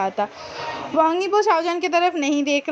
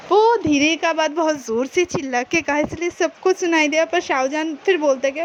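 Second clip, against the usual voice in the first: usual speaking voice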